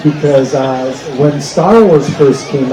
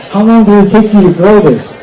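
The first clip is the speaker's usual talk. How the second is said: lightly voiced nosy stranger, a bit higher; curious bar guy; 5 semitones higher, 3 dB louder than usual. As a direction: ask it like a gawking drunk at the bar. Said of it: "and he goes".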